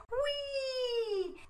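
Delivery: very high soft whee; fly up with joy; gleeful whee. A narrator's reading. Froggy flying through air.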